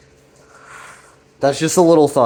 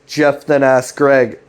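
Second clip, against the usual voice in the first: ordinary delivery, nothing marked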